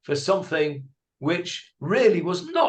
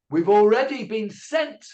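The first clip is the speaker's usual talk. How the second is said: normal talking voice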